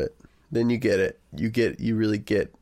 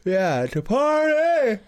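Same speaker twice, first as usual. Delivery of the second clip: silly cheer; 13 semitones higher, 3 dB louder, much slower than usual. Acted. Mock cheer.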